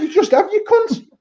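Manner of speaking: mock-wailing, very high-pitched retort; indignant child's protest. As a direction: play a kid protesting, pitch way up. Acting hit child's protest to dad.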